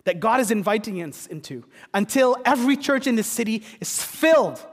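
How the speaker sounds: rally the church to join the feast; preaching at full force. Acted. Pastor urging his congregation to seek the lost, fervent preaching.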